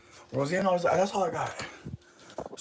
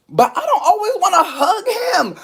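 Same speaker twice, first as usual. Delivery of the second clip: high, louder laughing squeal; laugh-crying; 7 dB louder than usual. Real, not acted. Laugh-cry heard, very high.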